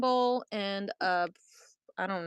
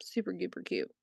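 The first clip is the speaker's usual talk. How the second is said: soft breathy whisper; 3 semitones lower, 8 dB quieter than usual. Real, not acted. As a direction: whisper it to yourself. Whispered aside over item.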